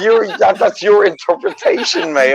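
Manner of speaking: high squeaky laughing denial; laughing protest. Real, not acted. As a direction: giggle out the defense.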